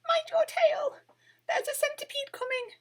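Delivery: very high whispered squeak; tiny voice. A narrator's reading. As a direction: warn him in a tiny whisper. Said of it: "squeaked Winnie in her tiny voice".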